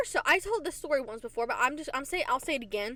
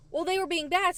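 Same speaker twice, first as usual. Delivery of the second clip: higher-pitched imitation of excuse-making; pitched-up self-justifying retort; mock defensive; 7 semitones higher, 4 dB louder than usual. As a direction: lightly mimic a defensive teacher. Imitating teachers defending the punishment.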